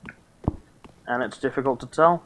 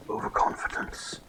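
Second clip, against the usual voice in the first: hushed mock-solemn; 11 dB quieter than usual. Streamer whispering a maxim after his overconfidence backfires, mock-solemn. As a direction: whisper it like a grave proverb, pausing between phrases.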